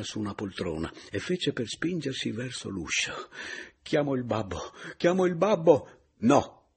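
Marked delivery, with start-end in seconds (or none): 3.8-6.1 high cry building to a loud call; distraught cry. Acted. Distraught woman breaks for the door. 6.2-6.7 loud, abrupt cry to stop her; shouted. Acted. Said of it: "le gridai".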